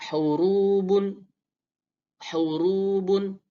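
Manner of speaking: hurubun slow, airy h; Arabic ha. Shown as a demonstration. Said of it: "Lalu dhamma".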